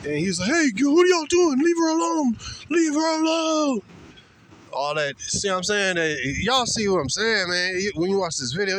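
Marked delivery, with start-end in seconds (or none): 0.5-3.8 high, loud hammed-up tough guy; mock aggressive boyfriend. Voicing the boyfriend confronting police, loud high mock shout. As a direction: bark at the cops like a wannabe hero. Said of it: "assertive and aggressively".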